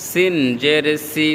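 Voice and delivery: 'সিন' and 'সি' with a thin, hissed s. Shown as a demonstration. Teacher drills the letter seen, 'সি' with a thin s. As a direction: keep the s of 'সি' fine. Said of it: "চিকন হবে".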